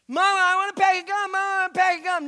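put-on high, loud begging kid voice; child pleading for gum; whiny child. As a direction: whine like a little boy at checkout.

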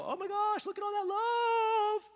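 very high, gushing mock amazement; amazed surgeon. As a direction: squeal in slow, exaggerated wonder. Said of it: "A surgeon doesn't open you up and go".

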